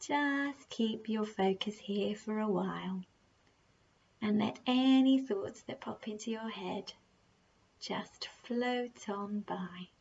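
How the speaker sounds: slow, soft meditation voice with unhurried, drawn-out phrases; guided meditation. Acted. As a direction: gently guide the listener through their breathing, leaving long pauses.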